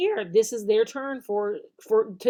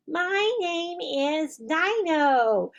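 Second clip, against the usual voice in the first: slow, far puppet voice for Dino; 5 semitones higher, much slower than usual. Shown as a demonstration. Teacher voicing puppet Dino answering, slow. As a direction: give the puppet its own voice. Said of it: "Let Dino ask the question".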